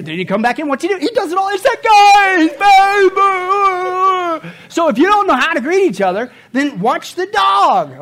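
high, loud and slowing, nearly crying out; over-the-top excitement. Acted. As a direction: shout with joy at the return. Dog greets you, crying out.

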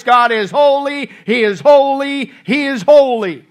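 loud, slow, high proclaiming of holy; loud crying out. Retold or imitated. Angels fallen prostrate before God, slow loud cry. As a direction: call out each holy slowly and loudly. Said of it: "crying out with a loud voice".

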